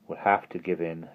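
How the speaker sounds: muted anger; low and angry. A narrator's reading. Simon admits defeat to the others, low and angry. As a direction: keep the anger held down. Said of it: "low and angry".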